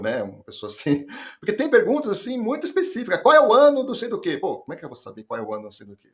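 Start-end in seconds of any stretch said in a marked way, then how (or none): 3.2-4.4 high, loud mock quiz question; raised-pitch, punched-out question; test question. Acting out a very specific test question. 4.4-6.1 fast, lower mock complaint; hurried, dropped-voice protest; stumped candidate. Acting out the stumped candidate's reply.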